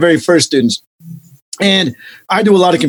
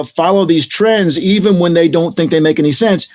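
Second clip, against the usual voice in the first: ordinary delivery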